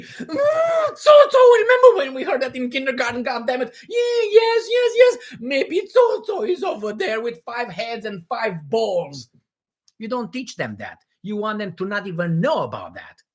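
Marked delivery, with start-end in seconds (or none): 0.0-9.3 high, wailing fake panic; mock terror. Imagined soldiers recalling a kindergarten scary story, mock terrified. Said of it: "You don't want them to be".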